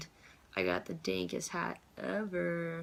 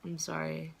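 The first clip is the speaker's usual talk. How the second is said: ordinary delivery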